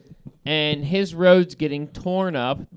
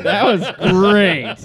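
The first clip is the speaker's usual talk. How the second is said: high, louder and slow, laughing it out; 6 semitones higher, 8 dB louder, much slower than usual. Real, not acted. Host laughing at the crew's joke, loud and slow. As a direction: praise the joke while still laughing.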